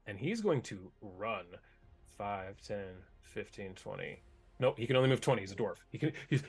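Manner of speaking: usual speaking voice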